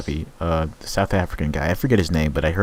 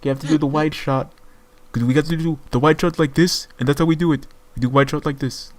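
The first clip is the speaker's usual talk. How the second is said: put-on higher, lightly accented Hong Kong English; Jackie Chan voice; 8 semitones higher than usual. Friend imitates Jackie Chan explaining how to film fights, higher voice. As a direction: coach the wide shot like Jackie Chan directing. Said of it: "I sound like Jackie Chan almost".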